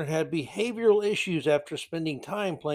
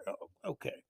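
soft unvoiced aside; sarcastic whisper; 14 dB quieter than usual. Real, not acted. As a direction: breathe it out dismissively. Sarcastic reaction to lawsuit claim.

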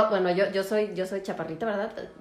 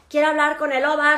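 higher, louder demanding relatives; mock pushy relatives; 6 semitones higher, 6 dB louder than usual. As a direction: imitate the big group insisting. Relatives demanding senior doctor. (imitación ligera de familiares exigentes, más agudos y fuertes)

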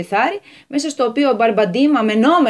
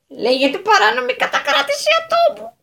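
higher, formal legal pronouncement; pompous lawyer; 13 semitones higher than usual. A narrator's reading. Lawyer stating the charge to the landowner, pompous. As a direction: announce the legal term gravely.

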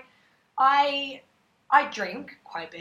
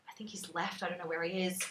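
whispering; 7 dB quieter than usual. Retold or imitated. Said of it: "I'm texting my girlfriends and I'm like".

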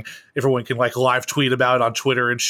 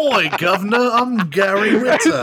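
mock British accent that starts off Australian, done to tease a co-host; John Oliver impression; 9 semitones higher, 4 dB louder than usual. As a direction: laugh through a cartoonish Cockney-British voice. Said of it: "it started off Australian and then went British".

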